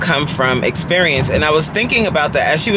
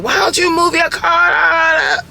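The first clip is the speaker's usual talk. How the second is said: higher, slow, hammed-up yell at a stuck driver; mock road rage; 12 semitones higher than usual. Impatient driver yelling at a car in the way, mock yell. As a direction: play the furious driver, half joking. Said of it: "instead of me getting upset and being like".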